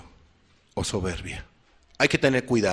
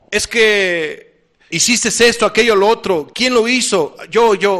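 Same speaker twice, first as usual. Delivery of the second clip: mock eager self-blame; 7 semitones higher, 7 dB louder than usual.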